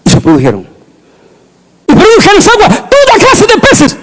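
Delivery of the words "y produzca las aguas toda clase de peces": high, ringing command with a laugh; mock divine command. Preacher voicing God with a laugh for the congregation. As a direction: call the fish forth, half laughing. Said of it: "los animales lo dijo así".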